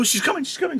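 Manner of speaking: high-pitched excited Juliet. Acted. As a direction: squeal it as she arrives. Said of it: "She's excited now".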